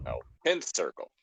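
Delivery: ordinary delivery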